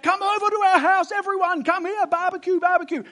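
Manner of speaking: high, loud put-on voice calling everyone over; hammed-up joyful yelling to the neighbours; shouting invitation. Family shouting to everyone to come for a barbecue, loud and high. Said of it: "they're like shouting out".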